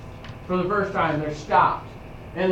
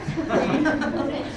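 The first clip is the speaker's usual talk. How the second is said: laugh; 6 semitones higher, 4 dB quieter than usual. Real, not acted. Laughs at own voice.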